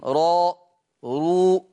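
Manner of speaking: راء، رو with a full, heavy, emphatic r. Shown as a demonstration. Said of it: "مضمومة فحكمها التفخيم".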